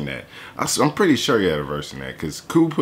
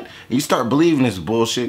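ordinary delivery

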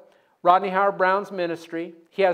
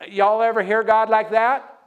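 usual speaking voice

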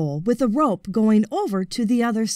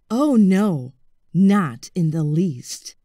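slow, low crocodile savouring the taste; slow low satisfied crocodile; 3 semitones lower, slower than usual. Acted. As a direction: relish every syllable.

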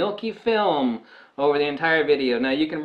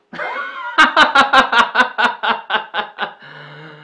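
loud real laugh, high and long; hearty laugh; 9 semitones higher, 7 dB louder than usual. Real, not acted. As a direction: burst out laughing at your own joke. Laughing at his own Aussie impression.

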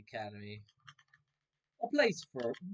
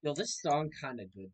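usual speaking voice

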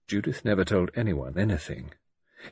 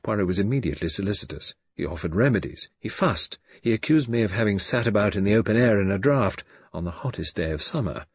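ordinary delivery, nothing marked

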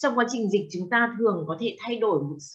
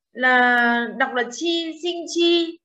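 chi drawn out slowly, level tone; slow emphasis; much slower than usual. Shown as a demonstration. Teacher insisting to the class the reading is chi: slow. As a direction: stretch out là chi deliberately. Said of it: "Rõ ràng".

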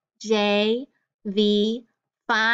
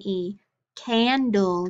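ordinary delivery

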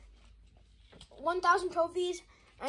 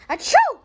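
fake sneeze; 8 semitones higher, 14 dB louder than usual. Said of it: "fake sneeze".

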